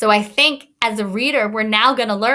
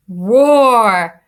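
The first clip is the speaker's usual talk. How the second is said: loud, drawn out roar; big roar; 8 dB louder than usual. Shown as a demonstration. Teacher saying roar to the class, loud and drawn out. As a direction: roar like a lion. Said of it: "I made my voice a little bit bigger".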